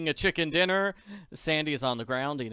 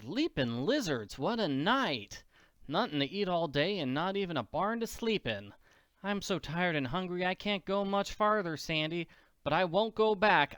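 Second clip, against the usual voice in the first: put-on higher, plucky girl voice, tired but stubborn; a hungry orphan refusing to turn back; little orphan girl Annie; 5 semitones higher than usual. Annie tells Sandy she won't go back, higher girl voice.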